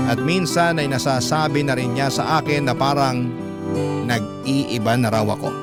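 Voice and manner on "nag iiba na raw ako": ordinary talk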